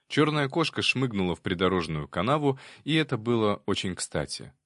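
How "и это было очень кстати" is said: ordinary delivery, nothing marked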